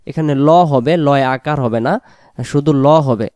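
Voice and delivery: normal talking voice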